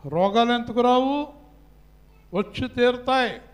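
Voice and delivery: high, loud pulpit proclamation; full-force preaching. Real, not acted. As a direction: ring it out to the congregation. (గట్టిగా, హెచ్చు స్వరంతో ప్రసంగం)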